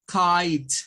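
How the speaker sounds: KITE said "kait", loud and isolated; English pronunciation demo. Shown as a demonstration. Teacher modelling a new word, loud clear demo. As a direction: punch out KITE with silent E. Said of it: "Se lee como kite".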